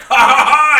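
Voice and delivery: big, loud, high-pitched belly laugh; booming mock guffaw; Drax laughing. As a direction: burst out in a hammed-up roar of laughter. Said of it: "Drax is like".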